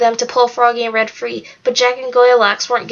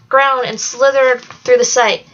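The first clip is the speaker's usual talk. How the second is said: ordinary delivery, nothing marked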